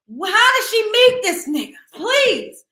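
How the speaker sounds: high, loud outraged disbelief; can't believe it, cry it out; shocked disbelief. Real, not acted. Shocked by his name.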